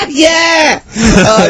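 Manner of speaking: squealing high laugh breaking into a yelled yeah; high cackle into a shouted yeah; shrieking laugh. Real, not acted. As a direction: laugh helplessly, then shout it out. Doubled up at the joke.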